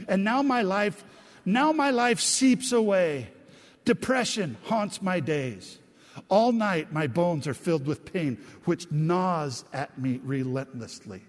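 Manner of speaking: slow, emphatic scripture lament; dramatic lament reading. Acted. Preacher reads Job's sad lines to the congregation, dramatic lament. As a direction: draw out each mournful line with force.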